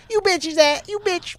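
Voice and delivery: high, fading squeaky tough-guy voice. Retold or imitated. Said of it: "picture [name] being like".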